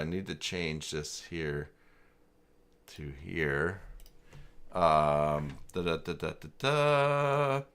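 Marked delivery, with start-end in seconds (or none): none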